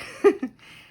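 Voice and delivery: high, loud laugh through tears; sudden tearful laugh; teary laugh. Real, not acted. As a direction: laugh to cover the emotion.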